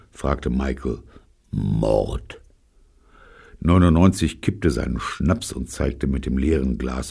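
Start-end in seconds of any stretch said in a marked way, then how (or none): 1.5-2.4 low, grim one-word answer; grim convict. A narrator's reading. Convict naming the crime to Michael, grim and low. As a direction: drop the word low and hard.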